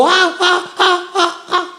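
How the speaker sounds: hammed-up panicking mother crying out; mock panicked cries. Teacher mocking a mother who only screams for her baby. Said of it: "Hindi yung magsisigaw".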